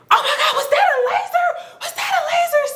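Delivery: very high, fast laughing scream; freaked out by the laser; panicked laughing shriek. Retold or imitated. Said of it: "I'm just like".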